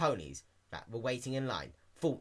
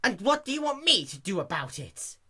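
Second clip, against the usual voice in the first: higher, snippy sarcastic retort; rude clerk; 10 semitones higher, 7 dB louder than usual. A narrator's reading. Mayor clerk snubbing Dash, snippy sarcasm. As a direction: brush her off rudely.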